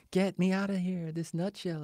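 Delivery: high, small comic voice; trapped-in-a-nutshell voice. Pretend. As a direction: plead playfully from inside a tiny shell. Jokes as someone trapped in a nutshell.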